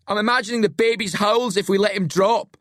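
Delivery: high, gleeful jeer; grinning taunt. A narrator's reading. Locke imagines dropping Billy, grinning taunt. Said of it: "Locke grins".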